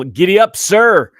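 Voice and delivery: loud, high cowboy giddy up; excited holler. Real, not acted. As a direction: whoop it out like a rodeo call. Cheery shout-out greeting a viewer.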